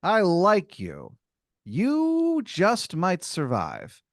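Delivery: high, slow, knowing pronouncement; smug fighter. Acted. Sumo wrestler sizing up the player, slow smug approval. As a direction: savour each word like a cocky champion. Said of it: "he smiles and he, like, folds his arms".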